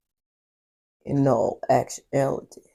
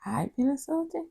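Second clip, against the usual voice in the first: mock offended; 10 semitones higher than usual. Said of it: "After everything you said to me".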